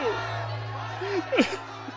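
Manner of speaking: high breathy cry of agony; pained scream. Acted. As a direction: let out a cry of pain. Scream after shot. (grito de agonia)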